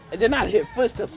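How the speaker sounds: normal talking voice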